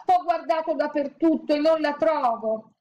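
high, wailing complaint; tearful. A narrator's reading. Woman crying to the statue, tearful. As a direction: whimper it in distress. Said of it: "piangeva e diceva".

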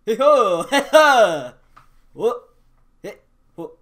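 loud, high cheering whoops; wild whooping. Acted. Dollar store weed skit, hollering to nobody in wild triumph. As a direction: whoop it like a victory shout.

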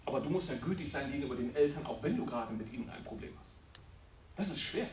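normal talking voice

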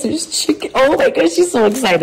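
high squeal over the toys; excited pet talk. Real, not acted. Owner unboxing toys next to her dog, high excited voice. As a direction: gush at the dog.

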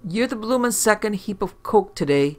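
cockney technician. Acted. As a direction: play the chatty London tech, up and loud.

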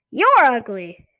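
squeaky dummy insult, high and loud; Slappy. A narrator's reading. Dummy insults the sister back, squeaky puppet voice. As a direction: throw it back like a cheeky puppet. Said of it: "Slappy said in Lindy's squeaky voice".

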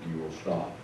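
lightly voiced wise master, low and soft; Yoda. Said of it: "was he like Yoda and just said".

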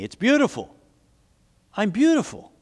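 high, wondering realization; amazed delight. A narrator's reading. Teacup sees itself in the mirror, amazed. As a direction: lift high in mild wonder.

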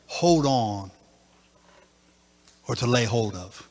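hushed after-climax teaching; quiet reflective preaching. Acted. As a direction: bring them down gently.

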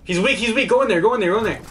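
high, urgent calling out; urgent callout. Real, not acted. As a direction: push the team in urgently. Urging teammates to push in.

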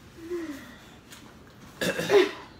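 hard choking cough. Real, not acted. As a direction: cough as if food stuck. Choking on cheese.

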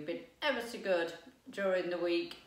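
ordinary talk, nothing marked

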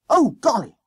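high, loud schoolboy gasp; gasped alarm. A narrator's reading. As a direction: gasp at a sudden danger. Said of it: "he gasped".

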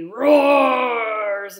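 loud, slow roar; loud, slow lion-cub roar. Acted. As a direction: boom it out like a roar. Cub roars again.

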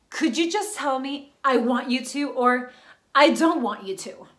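loud, emphatic plea for an answer; frustrated, forceful ultimatum; exasperated demand. Acted. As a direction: press each option hard and loud. She demands a straight answer.

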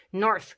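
"North" ending in a voiceless dental "th"; th with tongue between teeth. Shown as a demonstration. Teacher demonstrating the tongue position to learners, careful "North". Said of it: "t cu limba între dinți".

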